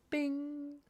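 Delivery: high little bing sound for a star appearing; mock ping. Reader marking the first star, high sound-effect bing. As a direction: chime it like a tiny bell. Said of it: "as Nigel Sadler would say".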